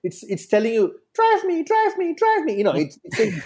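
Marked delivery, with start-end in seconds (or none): 1.1-2.5 car voice. Retold or imitated. Car engine voiced, urging.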